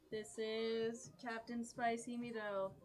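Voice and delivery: deliberate, careful captain's message; telepathic message. Acted. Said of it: "telepathically reach out to Elise again".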